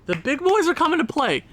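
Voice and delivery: high-pitched, thrilled announcement; excited hype call. Real, not acted. Celebrating star players' goals.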